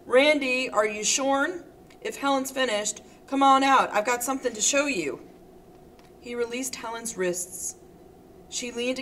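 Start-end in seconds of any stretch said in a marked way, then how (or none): 0.0-5.2 louder, higher calling from the door; a bright, loud call through the door; loud and welcome. Acted. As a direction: call in cheerfully to interrupt. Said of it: "loud and welcome".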